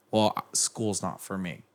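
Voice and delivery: usual speaking voice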